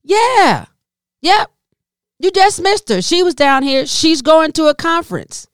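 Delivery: 1 carefree valet. Retold or imitated. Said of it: "Third guy".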